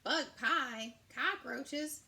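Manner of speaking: loud shocked questions; horrified surprise. Acted. Forest animals aghast at the bug pie menu. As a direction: recoil in disgust.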